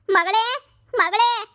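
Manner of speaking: tearful, drawn-out wail for her daughter; mother's anguished cry of "ಮಗಳೇ". Acted. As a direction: cry out slowly for the lost child. Mother can't find her baby.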